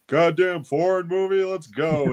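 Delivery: dad complaining about the foreign movie, mock gruff; grumpy dad. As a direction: grumble like a dad ready to leave. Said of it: "My dad originally ... was like".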